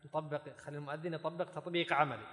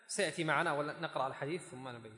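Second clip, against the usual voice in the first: usual speaking voice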